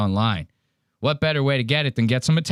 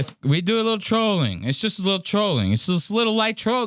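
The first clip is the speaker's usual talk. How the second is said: lightly raised mock Trump phrasing; a hint of Trump's sing-song catchphrase; Trump impression; 6 semitones higher than usual. Host echoing a Trump soundbite, lightly mocking. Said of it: "You know how Donald Trump says it".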